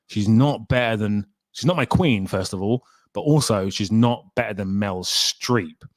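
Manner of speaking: ordinary delivery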